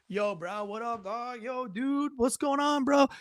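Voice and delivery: soft, casual dude-speak imitation; mock bro talk. Shown as a demonstration. Host demonstrating how he could talk bro-style, softly mocking. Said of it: "I can say".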